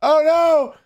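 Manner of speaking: high, loud panicked cry; alarmed shout. Real, not acted. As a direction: yell it in real alarm. Dying in the game.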